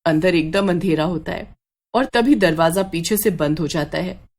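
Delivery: plain reading voice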